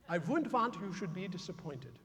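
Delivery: put-on quiet, measured Russian-accented grandmother. Grandmother cautions her teenage grandson, quiet Russian accent. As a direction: repeat it softly in a Russian accent. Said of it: "she would say to me in that delightful Russian accent".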